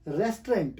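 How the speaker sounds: "रेस्टोरेंट" squeezed to two syllables, RES-trent; correct pronunciation. Shown as a demonstration. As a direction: drop the middle vowel of रेस्टोरेंट. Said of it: "actually ये लफ्ज़".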